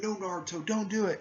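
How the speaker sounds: high-pitched pleading; acted alarmed plea. Friends plead with the hero.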